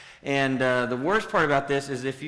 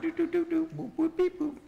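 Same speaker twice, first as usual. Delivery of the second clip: high beeping like a phone; phone GPS noises. Retold or imitated. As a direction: chirp out the phone's beeps. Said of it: "Do y'all's phones make those noises?".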